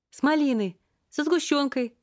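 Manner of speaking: high squeaky hawking call; squeaky sales cry. Acted. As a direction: keep calling out in a squeak. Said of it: "продолжила она бесполезные попытки".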